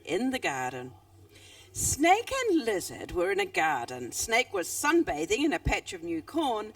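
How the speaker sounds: put-on lively read-aloud voice for children; animated storytelling with character voices; story reading. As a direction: tell it like a bedtime story. Author reads her story aloud.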